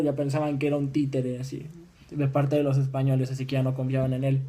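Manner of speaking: normal talking voice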